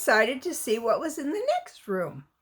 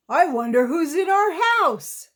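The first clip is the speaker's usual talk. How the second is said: cry out; 5 semitones higher, 5 dB louder than usual. Acted. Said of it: "cried Papa Bear".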